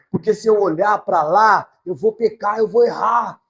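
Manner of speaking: praying loudly for help; loud pleading. Acted. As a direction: cry out to God against sin. Pleading prayer, raised voice.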